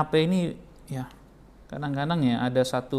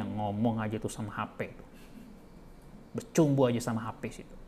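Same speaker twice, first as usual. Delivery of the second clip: inner grumble; 6 semitones lower, 7 dB quieter than usual. Retold or imitated. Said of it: "Dalam hati istrinya".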